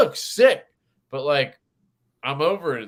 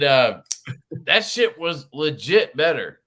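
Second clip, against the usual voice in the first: usual speaking voice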